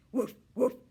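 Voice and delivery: loud, high playful woofs; puppy barks. A narrator's reading. Puppy barking at the hamster to play. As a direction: bark like an eager puppy. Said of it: "she barked".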